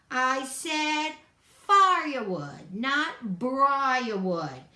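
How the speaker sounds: slow shouted correction; yelling. A narrator's reading. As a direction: varmint yelling at the bunny over thorns. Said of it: "and he yelled".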